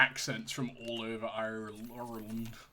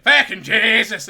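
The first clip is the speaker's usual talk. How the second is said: mock Irish shout, loud and slow; hammed-up Irish "Fucking Jesus!" yelled; 8 semitones higher, 16 dB louder than usual. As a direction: bellow it like a stage Irishman. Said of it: "go to Northern Ireland".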